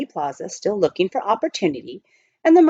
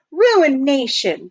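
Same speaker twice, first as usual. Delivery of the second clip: despairing sigh; 5 semitones higher, 5 dB louder, much slower than usual. Acted. Jack despairs at the delay.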